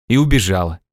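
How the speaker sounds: ordinary delivery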